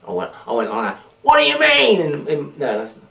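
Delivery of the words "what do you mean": high, loud mock American accent. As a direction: put on a brash American accent. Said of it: "in my American accent".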